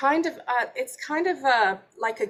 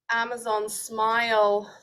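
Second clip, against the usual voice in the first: usual speaking voice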